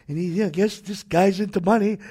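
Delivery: normal talking voice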